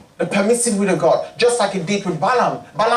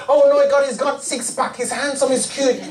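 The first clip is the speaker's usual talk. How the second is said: higher, gushing over a crush; mock swooning; 6 semitones higher than usual. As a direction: gush like a smitten teenager. Swooning over handsome man.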